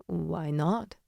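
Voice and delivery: soft, slow, teasing question; slow tease. A narrator's reading. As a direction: draw it out, testing her. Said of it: "he asks slowly".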